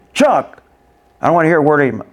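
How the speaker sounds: sharp high name, then scolding; teacher yelling. Acted. Teacher interrupting a kid's chatter, mock yelling. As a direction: cut the pupil off loudly. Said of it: "being yelled at by a teacher".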